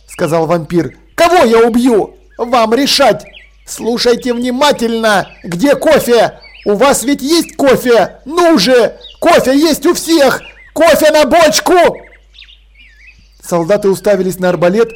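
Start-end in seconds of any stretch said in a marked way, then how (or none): 1.1-11.7 menacing demand. A narrator's reading. Vampire threatens soldiers for coffee.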